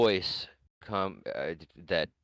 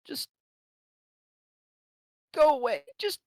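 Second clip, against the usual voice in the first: frightened plea. Acted. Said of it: "ghost woman".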